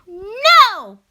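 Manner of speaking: high, loud squeaky pig cry; a frightened piglet shouts back; little pig crying out. A narrator's reading. Little pig refusing the wolf through the door. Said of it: "cried the little pig".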